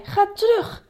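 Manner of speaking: high angry command; shouted. A narrator's reading. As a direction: shout them back down. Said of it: "schreeuwde hij".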